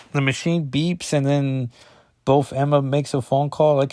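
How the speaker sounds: ordinary talk, nothing marked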